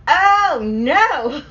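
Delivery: high, loud alarmed "oh no"; big, high-pitched storyteller's dread; dismayed outburst. Retold or imitated. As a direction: blurt it out in retold dismay. Retold reaction to the box.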